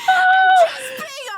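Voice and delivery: high, loud words broken by laughter; cracking up while insisting; laughing. Real, not acted. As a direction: laugh it out, barely getting words through.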